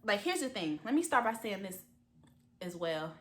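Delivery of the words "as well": ordinary talk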